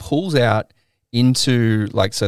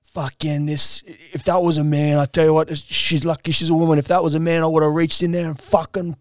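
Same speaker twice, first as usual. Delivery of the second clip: raised, heated rant imitating the driver; 7 semitones higher than usual. Passenger retelling the taxi driver's road rage, heated mock rant. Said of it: "the whole time he's going".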